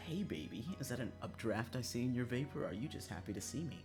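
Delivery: ordinary talk, nothing marked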